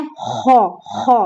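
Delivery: खो with a full, rounded heavy kh; heavy kho sound. Shown as a demonstration. Teacher showing students the खो sound, rounded and heavy. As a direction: say खो deep and rounded, not खा. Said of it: "कैसे पढ़ना है?".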